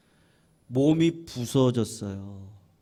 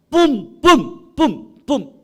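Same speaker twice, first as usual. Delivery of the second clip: loud, high "뿜" blasts like a horn; preacher mimicking a trumpet blast; trumpet blast imitation; 12 semitones higher, 11 dB louder, much slower than usual. Shown as a demonstration. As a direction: blow each 뿜 like a trumpet. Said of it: "뿜 이렇게 분다는 거죠".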